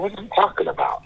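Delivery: high-pitched baffled protest; incredulous retold question; retold disbelief. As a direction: ask it in stunned disbelief, pitched well up. Retold phone call, baffled by news.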